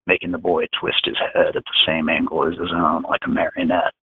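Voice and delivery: usual speaking voice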